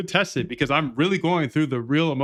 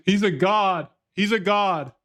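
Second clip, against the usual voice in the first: higher, crowing hype; gleeful boast; 7 semitones higher than usual. Real, not acted. Streamer crowning himself after the win, gleeful boast. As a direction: announce it like a champion.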